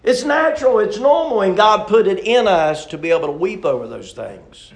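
loud sermon voice, starting high and settling; impassioned preacher reassuring the congregation loudly; forceful preaching. Real, not acted. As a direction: insist on it, then bring it down.

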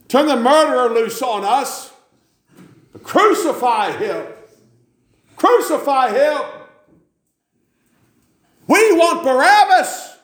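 very high, loud, slow mob roar; mob screaming. Acted. Preacher voicing the mob demanding Barabbas, screaming. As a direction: scream it like a baying crowd.

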